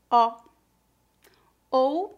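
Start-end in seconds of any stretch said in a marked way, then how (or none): 0.1-0.4 ó said as open /ɔ/; an open, wide ó. Shown as a demonstration. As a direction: say ó like a quick "aw". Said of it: "exatamente como a palavra".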